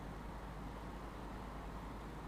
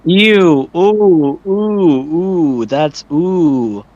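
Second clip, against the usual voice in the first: disgusted ooh; 3 semitones higher, much slower than usual. Real, not acted. Reacting to strange soft voice.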